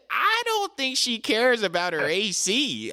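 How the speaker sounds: high, incredulous sarcasm; mock-disbelieving comeback; sarcastic retort. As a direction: scoff at the tenant's request. Sarcastic reply to the tenant.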